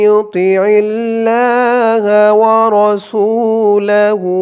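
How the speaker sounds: slow, drawn-out recitation of Tilka হুদুদুল্লাহ; recited verse. Shown as a demonstration. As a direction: chant Tilka হুদুদুল্লাহ slowly with long vowels. (ধীর সুরে তিলকা হুদুদুল্লাহ তিলাওয়াত)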